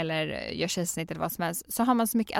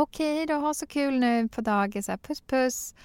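put-on higher singsong farewell to a child; sweet goodbye; 6 semitones higher than usual. Mother cooing goodbye to son at daycare, higher. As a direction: coo goodbye kisses at the kid.

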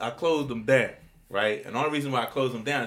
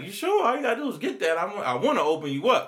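high pleading; 9 semitones higher, 5 dB louder than usual. Retold or imitated. Retold pleading with owner.

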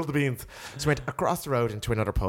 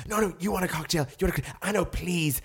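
higher pleading mimic of the date; imitating pushy date; 8 semitones higher than usual. Mimicking the pushy date to friends, higher pleading. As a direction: beg like the eager date. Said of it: "he's like".

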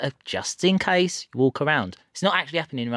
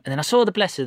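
ordinary talk

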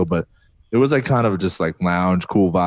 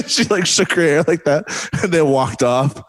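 laughing through the words, voice shooting high; breaking into laughter as she tells it; 9 semitones higher than usual. Real, not acted. Laughing while telling Mariah story.

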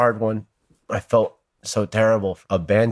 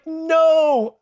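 crying out. Retold or imitated. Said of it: "I burst out crying".

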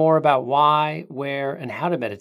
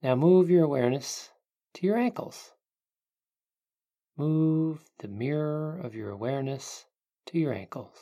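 guided meditation; 3 dB quieter than usual. Acted. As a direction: ease them into calm, unhurried.

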